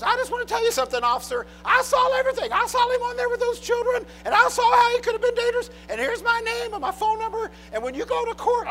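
put-on very high-pitched fussy old lady; hurried old lady squawking at an officer. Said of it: "this old lady".

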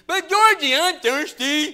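high, loud put-on whine; real loud. Pretend little brother pestering George, real loud. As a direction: holler high over the scolding, hammed-up. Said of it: "real loud".

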